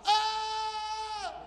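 high mock scream of fright; scared yelp. Pastor imitating a scared person, high mock yelp. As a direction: let out a startled yelp.

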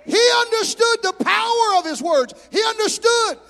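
loud, high preacher's cry; full-force preaching. Real, not acted. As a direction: preach it out at the top of the voice.

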